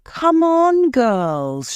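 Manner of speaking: high, loud rallying call; shouted call. A narrator's reading. Peter urging the girls to come, shouting. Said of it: "shouted Peter".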